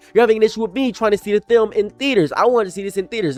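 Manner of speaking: frustrated tantrum; angry whining. Real, not acted. Whiny outrage at studio.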